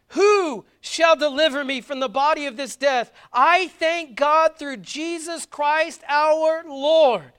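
loud, high, slow proclamation of the verse; proclaimed scripture. Acted. Preacher proclaiming Paul's answer to the church, loud and slow. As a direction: ring it out as the answer to everything.

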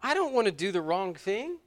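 ordinary delivery

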